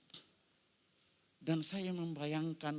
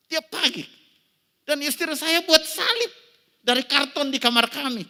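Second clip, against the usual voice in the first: much higher and louder; loud emphasis; 13 semitones higher, 14 dB louder than usual. Acted. Pastor telling how his wife made a cross, loud and high. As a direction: proclaim it to the hall. Preacher stressing wife's cross.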